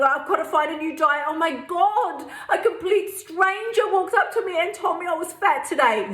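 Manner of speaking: high-pitched, breathless panic with a laugh; pretend-panicked, half-laughing; frantic panic. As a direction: rush it out like you're in hysterics. Panicked retelling to partner.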